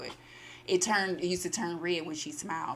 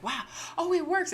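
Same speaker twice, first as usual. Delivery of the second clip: amazed wife; 11 semitones higher, 3 dB louder than usual. Retold or imitated. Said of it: "So she's like".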